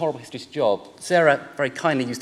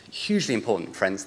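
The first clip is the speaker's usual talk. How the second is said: usual speaking voice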